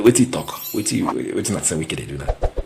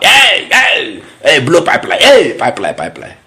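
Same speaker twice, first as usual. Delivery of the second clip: much higher, much louder mock yell of hey and pipeline; Wike shouting; 11 semitones higher, 11 dB louder, slower than usual. Politician yelling threats at rivals, loud mock shout. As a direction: bellow it like a rally chant, hammed up. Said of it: "he dey shout".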